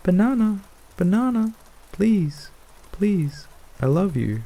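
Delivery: very high, squawky bird voice; begging parrot. A narrator's reading. Parrot pleads for banana sliver, squawking. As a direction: beg in short falling squawks.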